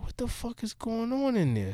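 high, hushed disbelief; retold bewilderment. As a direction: softly puzzle over what is happening inside. Retold bewilderment at a strange party.

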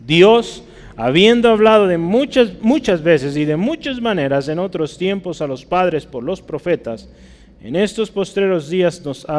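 loud, high-pitched preacher's reading; scripture proclamation. Real, not acted. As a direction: open the verse forcefully, then ease slightly.

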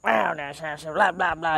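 mock grumbling gibberish; grumpy. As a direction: imitate a cranky, blabbering rant. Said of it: "just been a grumpy asshole".

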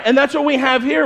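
ordinary talk, nothing marked

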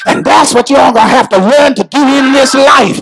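high, half-chanted preacher's shout; full-force preaching. Real, not acted. Preacher urging congregation to use faith's technique, preaching at full force. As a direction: preach it to a climax, long-held key words.

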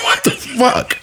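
very high squeal through laughter; laughing mock outrage. Husband retells cussing out his wife, laughing high-pitched mock outrage. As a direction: squeak out a retold curse while cracking up.

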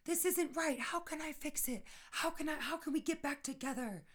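high, frantic questions; panicking about how to fix it; anxious pleading. Retold or imitated. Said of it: "I'm not ever questioning why".

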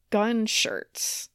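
ordinary delivery

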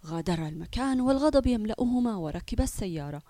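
plain reading voice

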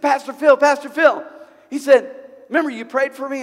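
a man running up, thrilled to see him; excited calling out. Retold or imitated. Healed man runs up to the pastor, calling excitedly.

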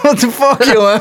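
high, loud shocked what the fuck; stunned outburst. Retold or imitated. Recalling meeting the huge man, a high stunned outcry. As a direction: blurt it out in disbelief. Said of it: "ik zeg".

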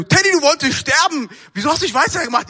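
high, loud reproachful outburst; acted exasperation at a friend; agitated scolding. Friend scolding him at the buoy after rescue, agitated scolding.

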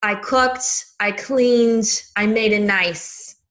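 imitated New York housewife accent, slowing at the end; Dorinda Medley. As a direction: put on a New York accent, each claim set apart. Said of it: "she kinda talks like this".

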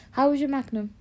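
normal talking voice

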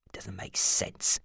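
low, slow, whispered doubt; cold suspicion. Acted. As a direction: mutter it darkly.

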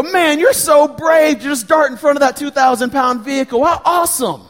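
high-pitched, hammed-up admiration; gushing mock praise. Dad pretending to admire his son darting past cars, gushing mock praise. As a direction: squeal with fake delight, far above normal voice. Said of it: "I'll tell you what I didn't say".

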